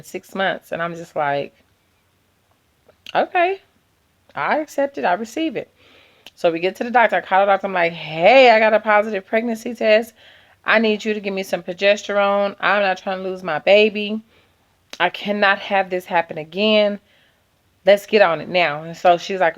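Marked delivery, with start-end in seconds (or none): 3.1-5.7 much higher, sing-song surrender; mock resigned acceptance. Retelling her reaction to the pregnancy, high mock acceptance. 7.9-18.8 louder, higher, pressing the doctor; urgent demand. Retold or imitated. Calling the doctor for progesterone, loud urgent insistence.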